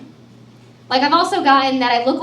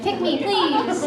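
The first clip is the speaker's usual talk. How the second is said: pleading puppy; 5 semitones higher, 7 dB quieter, much slower than usual. Retold or imitated. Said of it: "You're like".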